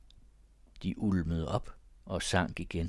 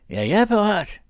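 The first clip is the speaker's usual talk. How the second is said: much higher, loud challenging yell; shouting; 13 semitones higher, 10 dB louder than usual. A narrator's reading. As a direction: bellow the challenge, ready to fight. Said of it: "råbte Thomas".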